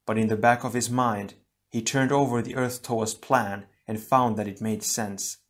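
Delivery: plain reading voice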